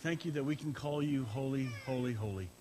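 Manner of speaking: soft, hushed congregational prayer; opening prayer before the sermon. Acted. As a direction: pray over the room, gently. Pastor opens with a prayer.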